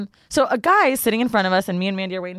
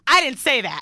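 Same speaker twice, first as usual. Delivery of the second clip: imitating a miffed woman, pitched higher; retold protest, a touch indignant; mock-offended quick denial; mildly indignant retort; 5 semitones higher than usual. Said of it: "remember when she got mad".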